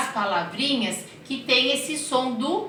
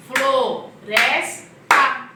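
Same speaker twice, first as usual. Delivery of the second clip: syllable by syllable; 3 semitones higher, 7 dB louder, much slower than usual. Shown as a demonstration. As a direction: spell "Floresta" out loud to the kids. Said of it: "Consoante, vogal".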